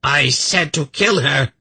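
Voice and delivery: high-pitched furious shriek; enraged shriek. A narrator's reading. Kabat orders her demon to kill, shrieking. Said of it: "Kabat shrieked again".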